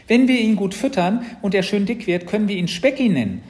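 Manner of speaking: put-on high, loud, bubbly child's scheming; eager little sister. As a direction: chatter it out like an excited little girl. Sister plans with new pet.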